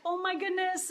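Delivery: mock excited exclamation, pitch up; lightly voiced delighted stranger; retold excitement. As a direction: imitate her lighting up. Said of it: "And she was so excited".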